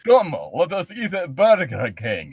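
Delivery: put-on thick Eastern European accent, commanding. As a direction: order them to dine, Eastern European style. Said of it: "I can't do a German accent".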